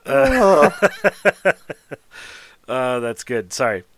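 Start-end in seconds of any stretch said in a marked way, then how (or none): 0.8-1.9 high, loud burst of laughing; real laughter. Real, not acted. Still cracking up at the joke.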